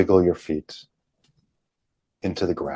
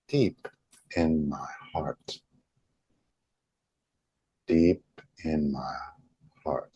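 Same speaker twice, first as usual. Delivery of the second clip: relaxed; 3 dB quieter than usual. Shown as a demonstration. Showing followers to relax and feel it in, relaxed. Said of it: "it's a relax. Feel that in".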